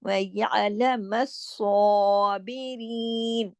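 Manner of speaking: व यालम स्वाबिरीन with throat ع and heavy स्वा; very slow Quranic reading; slow recitation. Shown as a demonstration. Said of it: "पूरा इसका हक अदा करना है".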